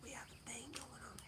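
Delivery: whispered like a secret. Pretend. Telling viewers a secret about TikTok, playful whisper. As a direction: lean in and whisper it to the phone.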